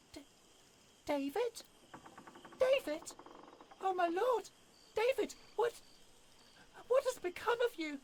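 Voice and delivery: a startled lady calling out in disbelief; shocked woman. Acted. Fanny recognising her wounded husband, high and shocked.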